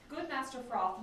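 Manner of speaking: ordinary delivery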